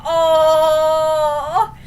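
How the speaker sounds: loud, long drawn-out coo; touched "aww" reaction. Retold or imitated. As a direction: melt out loud at a sweet moment. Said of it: "I was like".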